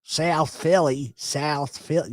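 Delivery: thick South Philly vowels, drawn out; slow, broad South Philly drawl; South Philly accent. Shown as a demonstration. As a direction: do the Philly thing. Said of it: "I can totally do the Philly thing".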